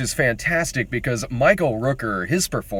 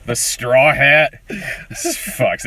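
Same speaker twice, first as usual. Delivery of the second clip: ordinary talk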